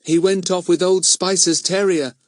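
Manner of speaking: lightly excited schoolboy; sudden remembering. A narrator's reading. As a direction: blurt out what just came back. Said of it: "suddenly remembering".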